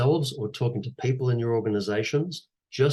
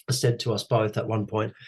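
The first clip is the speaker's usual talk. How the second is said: ordinary talk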